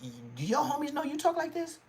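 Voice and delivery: very high-pitched, incredulous impression; scolding, squeaky put-on voice; Crip Mac. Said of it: "I'ma start talking like Crip Mac".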